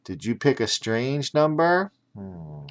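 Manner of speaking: plain reading voice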